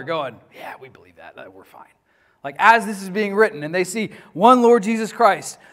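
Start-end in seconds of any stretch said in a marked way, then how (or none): 0.5-1.9 low, soft snickering aside. Retold or imitated. Arians snicker to each other during the reading, low and soft. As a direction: mutter it like a smug heretic sharing a joke. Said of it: "they're winking and they're snickering".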